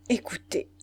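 a touch of strain in the voice; understated troubled appeal; altered, troubled voice. A narrator's reading. Julie asking Arthur to listen, slightly altered voice. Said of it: "lui dit-elle d'une voix altérée".

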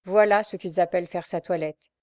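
ordinary delivery, nothing marked

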